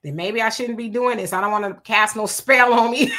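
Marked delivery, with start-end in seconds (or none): 1.3-3.2 breaking into a loud real laugh. Real, not acted. Host jokes to viewers about the witch doctor, laughing. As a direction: joke that tips over into laughter at the end.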